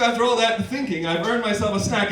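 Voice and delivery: ordinary talk, nothing marked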